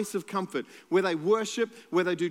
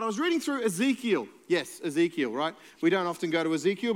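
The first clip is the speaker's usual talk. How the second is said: ordinary talk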